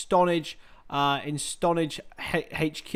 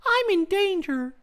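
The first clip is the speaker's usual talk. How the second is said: Ralph Wiggum voice. Retold or imitated. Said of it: "Simpsons quote, squeaky voice".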